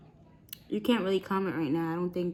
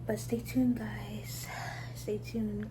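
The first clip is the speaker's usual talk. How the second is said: whispering; 6 dB quieter, much slower than usual. Real, not acted. Whispered teaser to viewers.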